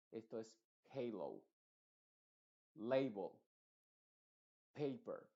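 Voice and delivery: "halo" "Label" "Paper" said each with the "ei" sound; one word at a time, clearly; English A as "ei". Shown as a demonstration. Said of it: "Esto no es hello".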